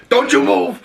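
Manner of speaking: high, forceful imitated yell; shouted command. Oz walking up on the kids, shouted command. Acting out gunman confronting kids.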